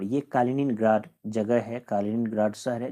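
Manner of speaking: ordinary talk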